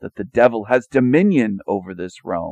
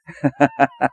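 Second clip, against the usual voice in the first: open laugh; 4 semitones higher, 5 dB louder than usual. Real, not acted. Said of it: "I'm laughing because it".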